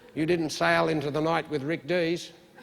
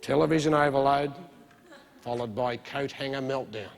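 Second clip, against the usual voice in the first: mock spooky warning. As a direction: tease out the looming catastrophe.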